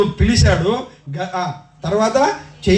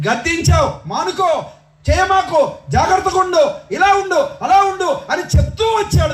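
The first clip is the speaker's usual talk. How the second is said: preaching at full force; 12 semitones higher than usual. Acted. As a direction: proclaim it to the congregation.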